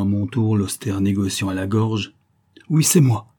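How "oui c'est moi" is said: réplique féroce, plus haute et plus forte; menace-le, plein de colère; répliquer férocement. A narrator's reading. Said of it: "répliquai-je férocement". (le jeune homme défie son patron, férocement)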